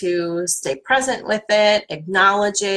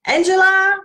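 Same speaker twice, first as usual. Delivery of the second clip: Angela sung out much higher and louder; playful high; 11 semitones higher, 6 dB louder than usual. Shown as a demonstration. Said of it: "And have fun with it".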